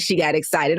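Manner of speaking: normal talking voice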